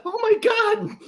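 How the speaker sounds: mock shocked gasp. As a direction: shriek out the shock, hammed-up. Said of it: "And she's just like".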